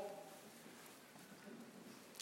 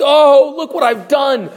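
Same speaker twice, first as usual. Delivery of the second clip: louder, higher anguished outcry; anguished cry; 4 semitones higher, 6 dB louder than usual. Acted. Peter weeping over his denial, crying out loud.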